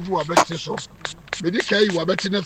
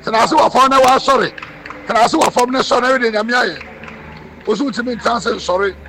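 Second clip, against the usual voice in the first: higher, raised-voice command; commanding call; 4 semitones higher, 3 dB louder than usual. Real, not acted. Prophet ordering the patient to sit and stand, commanding call. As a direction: call out the order for all to hear.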